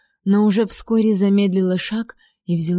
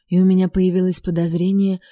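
usual speaking voice

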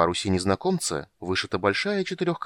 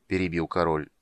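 ordinary delivery

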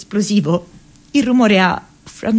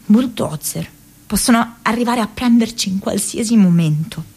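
ordinary talk

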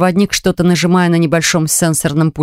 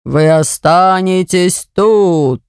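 sinister host voice; 3 semitones lower, slower than usual. Acted. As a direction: gloat over the trapped guests. The haunted portrait continues.

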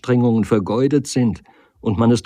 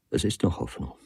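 usual speaking voice

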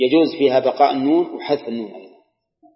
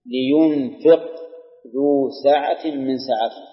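very slow Quranic recitation that draws out لينفق; slower than usual. Acted. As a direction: recite it slowly and stretch the first word. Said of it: "مثل قوله تعالى". (تلاوة قرآنية بطيئة جدا)

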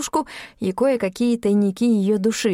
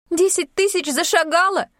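outraged; 10 semitones higher than usual. A narrator's reading. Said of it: "пошла пятнами Юлька".

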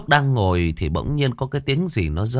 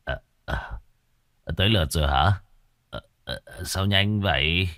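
put-on drunk slurring with hiccups; 2 semitones lower, 3 dB quieter than usual. A narrator's reading. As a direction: slur and hiccup between words. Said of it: "lè nhè vừa nói, vừa nấc cột".

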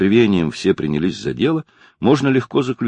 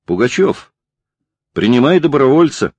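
raised, higher-pitched call; 5 semitones higher, 4 dB louder than usual. A narrator's reading. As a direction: call over to the team leader with a new recruit. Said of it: "крикнул я". (громко окликая)

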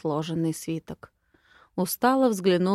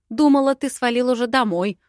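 higher, louder, irritated snap; prickly and raised in pitch; snappish retort; 5 semitones higher, 5 dB louder than usual. Acted. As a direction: snap back at an unwelcome intruder. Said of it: "огрызнулась я".

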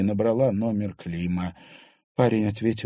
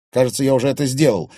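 loud, fast clipped reply; curt cutoff; 5 semitones higher, 9 dB louder, faster than usual. A narrator's reading. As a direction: cut it short. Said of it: "отрезал Клим". (резко отрезал, быстро)

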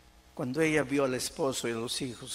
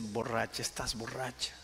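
retold contempt; 2 semitones lower, 4 dB quieter than usual. Husband looking down on his kneeling wife, quiet acted contempt. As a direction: dismiss her quietly with understated scorn. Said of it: "la miró con desprecio y dice".

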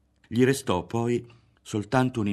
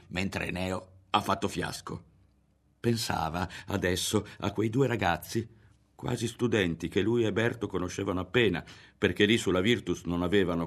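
ordinary delivery

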